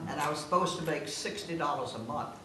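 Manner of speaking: ordinary talk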